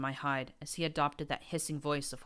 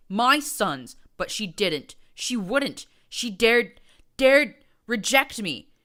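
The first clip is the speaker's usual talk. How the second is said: furious rant; 7 semitones higher, 11 dB louder than usual. A narrator's reading. Prince ranting, rejected.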